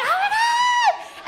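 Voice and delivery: very high, slow drawn-out shriek; thrilled shriek. Retold or imitated. The girlfriends reacting to the dildo mantle idea, drawn-out shriek. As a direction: shriek with delight.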